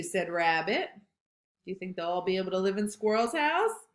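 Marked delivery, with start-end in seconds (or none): none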